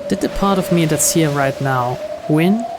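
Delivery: plain reading voice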